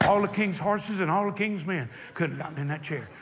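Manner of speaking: usual speaking voice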